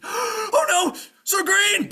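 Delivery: very high, loud terrified cry; panicked squire. Acted. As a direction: cry out in horror for your master. Squire sees witch shadow.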